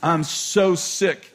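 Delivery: ordinary talk, nothing marked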